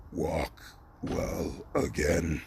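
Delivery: very low, soft, drawn-out voice; put-on deep voice, word by word; mock solemn command. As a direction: intone it like a spell, deep and slow. Izuku's acted command to Midnight.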